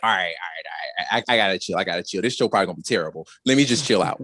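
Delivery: low, hurried muttering to oneself; self-calming. Retold or imitated. Host replaying anxiety before the show, low self-calming. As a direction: drop the pitch and talk yourself down quickly.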